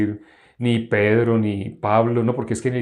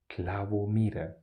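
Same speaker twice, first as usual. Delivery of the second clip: whispered; 2 semitones lower, 8 dB quieter, slower than usual. Shown as a demonstration. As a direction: whisper it, voiceless and hushed. Repeats letter meanings, whispered. (susurrado, sin voz)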